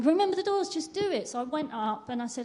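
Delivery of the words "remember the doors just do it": higher and louder push to act; insistent urging. Retold or imitated. God urging [name] to speak to the women, loud and insistent. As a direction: press her hard to just go.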